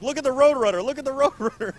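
high, loud mock yell; re-enacted excited yell. Host re-enacting his yell to the camera guy, excited yell. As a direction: yell it out high, like spotting a rare car. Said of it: "I yelled this out".

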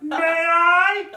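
put-on high, loud giggly man-child; Pee Wee Herman impression. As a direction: squeal it with a giggle. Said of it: "This is my Pee Wee Herman".

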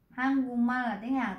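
ordinary talk, nothing marked

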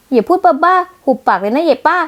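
slow furious put-down; angry retort. Acted. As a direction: spit out every word at the old woman. Angry retort at older woman.

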